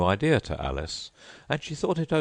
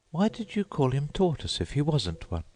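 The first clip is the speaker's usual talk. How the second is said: put-on much higher polite girl voice; Alice's voice; 10 semitones higher than usual. A narrator's reading. Alice thanking the Mock Turtle, high and polite. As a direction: pitch up into a polite young girl.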